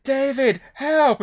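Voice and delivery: high, loud cry of "David, help"; kid yelling for help. Retold or imitated. Ginger kid in the water screaming to Hasselhoff for help. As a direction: shout his name as if drowning.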